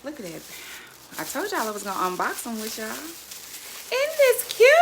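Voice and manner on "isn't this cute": high, slow, drawn-out squeal of delight; gushing. Real, not acted. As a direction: swoon over how cute it is. Gushing over the cookware.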